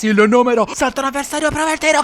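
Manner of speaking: rising excitement on the attack; excited commentary. Acted. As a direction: build up as he shoots.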